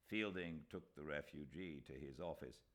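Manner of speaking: ordinary delivery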